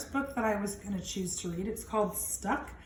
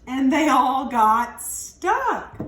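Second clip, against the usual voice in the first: put-on lively read-aloud for young kids; expressive picture-book narration; storybook reading; 3 semitones higher, 10 dB louder, much slower than usual. A narrator's reading. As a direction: read it out like storytime for little ones. Reads picture book to children.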